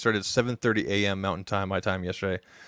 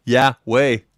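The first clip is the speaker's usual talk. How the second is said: excited; 3 semitones higher, 9 dB louder than usual. Real, not acted. Confirming the goal was reached.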